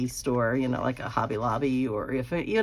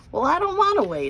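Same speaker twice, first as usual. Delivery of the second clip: put-on high, loud whining child; a toddler refusing to wait, much higher and louder; whiny toddler; 14 semitones higher, 6 dB louder than usual. Impatient toddler son in line.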